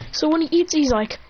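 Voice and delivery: ordinary delivery, nothing marked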